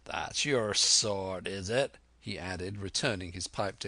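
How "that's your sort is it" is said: slow, drawn-out pirate taunt; scornful old sea dog. Acted. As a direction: sneer at a cowed crew.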